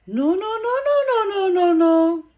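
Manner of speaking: high, loud, rapid-fire no-no-no; high sustained loud chant of no; calling out. Real, not acted. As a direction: call out urgently to agitated birds. Calls out to freaking-out birds.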